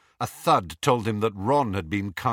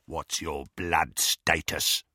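menacing werewolf; 4 semitones lower, slower than usual. A narrator's reading. Greyback's voice.

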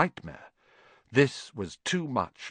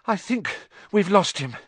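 higher, out-of-breath delivery; winded from running; panting; 9 semitones higher than usual. A narrator's reading. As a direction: let out a breath, then pant the words. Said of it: "Harry panted".

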